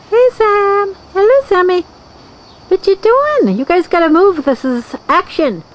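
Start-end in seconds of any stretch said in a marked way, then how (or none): 0.1-3.5 high sing-song greeting; pet greeting. Real, not acted. Owner greeting her pets brightly. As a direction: coo at the pets, pitched up high.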